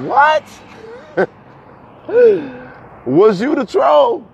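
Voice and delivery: high, disbelieving question; surprised. Retold or imitated. Asking a caller if they were the troll, in shocked disbelief. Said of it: "And I was like".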